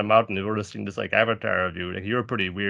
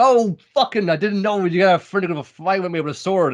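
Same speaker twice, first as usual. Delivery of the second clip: much higher, louder surprised yell; excited fighter; 8 semitones higher, 4 dB louder than usual. Retold or imitated. Podcaster voicing a fighter surprised by a sword, high excited yell. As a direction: blurt it like a fighter caught off guard.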